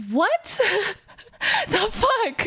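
high, laughing what the fuck; shocked into a laugh; incredulous laughing outburst; stunned disbelief. Retold or imitated. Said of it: "And I was like".

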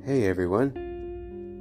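ordinary delivery

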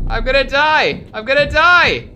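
high, loud mock panic; panicked wail. Real, not acted. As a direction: wail that you're doomed. Panicking in horror game.

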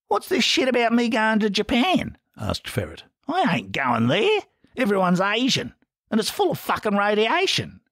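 a rough larrikin questioning his brothers; Ferret's voice. A narrator's reading.

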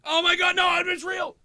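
mock panic. Fake panic again, joke.

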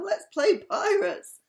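very high, loud and drawn out; hammed-up storybook excitement; excited proposal. A narrator's reading. Sam proposes pirates to the friends, excitedly.

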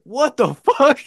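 loud, high-pitched disbelief; shocked coach. Retold or imitated. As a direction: imitate a coach yelling in astonishment. Coach reacts to the throwing up.

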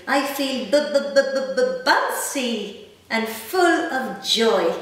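stuttered b-b-b bounce, slow and lifted; bouncy. A narrator's reading. Reading to a child, bouncing the word bouncy. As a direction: bounce on each b like a springy rabbit.

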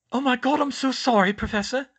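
high, loud, horrified apology; gasped apology. A narrator's reading. Hermione realizing she screamed at Dumbledore, high horrified gasp. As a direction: gasp it out, suddenly appalled at yourself. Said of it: "she gasped".

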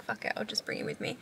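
whispered to herself; whispered thought. Retold or imitated. Telling viewers why she brought the dog, whispered. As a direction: whisper it like a little secret. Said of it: "so I was like".

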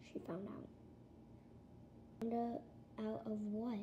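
whispering. Acted. Parents confer in secret.

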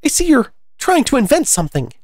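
very high bird character; high-pitched storybook suspicion; accusing pigeon. Acted.

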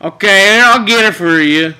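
ordinary talk, nothing marked

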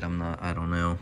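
normal talking voice

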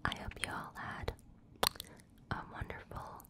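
ordinary talk

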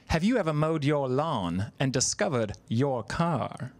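put-on slow, educated-sounding British accent. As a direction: deliver it as measured British English. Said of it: "said those in a British accent".

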